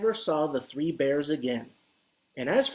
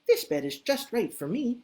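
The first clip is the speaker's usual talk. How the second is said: lightly voiced Goldilocks; 7 semitones higher than usual. Goldilocks pleased in bed.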